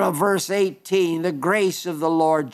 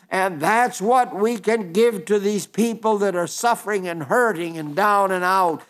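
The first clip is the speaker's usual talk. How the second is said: ordinary talk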